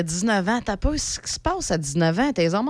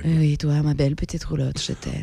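sensual voice; 6 semitones lower than usual. Shown as a demonstration. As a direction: breathe it to the trailer like a lover. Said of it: "tu fais ça avec une voix sensuelle".

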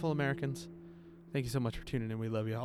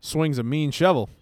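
loud, slow proclamation; slow hammed-up boast; mock announcer boast; 3 semitones higher, 9 dB louder than usual. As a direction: boom it out like a tagline. Joking about hero's shovel.

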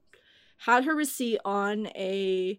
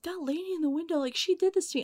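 higher, softer aside to a coworker; confiding aside; 6 semitones higher, 5 dB quieter, faster than usual. Retold or imitated. Teen worker tells a coworker about the rude customer, confiding.

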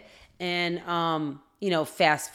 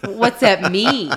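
slow, louder, laughing in disbelief; laughing confusion; 3 semitones higher, 8 dB louder, much slower than usual. Retold or imitated. Wife asking the tech about twins, slow laughing disbelief. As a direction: laugh out the question, dumbfounded.

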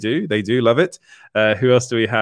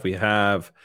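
usual speaking voice

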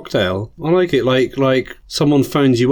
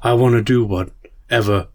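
Shatner impression; 5 semitones lower than usual. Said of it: "where he's like".

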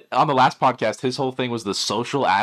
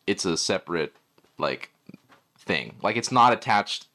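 much lower, flat synthesized speech voice; robot voice; 6 semitones lower than usual. Retold or imitated. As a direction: do a lightly robotic text-to-speech voice. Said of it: "and he's like".

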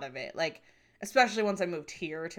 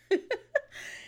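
quick airy laugh; short unvoiced chuckle; breathy laugh. Real, not acted. Laugh after whisper.

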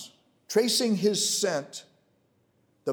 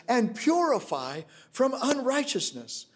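ordinary talk

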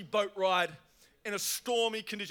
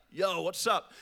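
usual speaking voice

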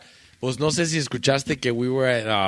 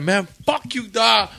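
retold angry comeback; 8 semitones higher, slower than usual. Retold backstage insult in an argument.

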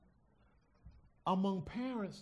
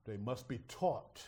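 solemn and slow; 6 semitones lower, 4 dB quieter, slower than usual. Real, not acted.

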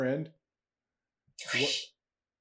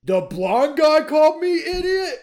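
outraged troll; 11 semitones higher, 10 dB louder than usual. Acted. GM as Dump, outraged.

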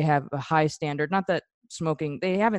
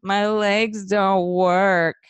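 slow, whiny complaining; 4 semitones higher, 4 dB louder, much slower than usual. Retold or imitated. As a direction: whine it like a spoiled kid. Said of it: "she has this very whiny voice".

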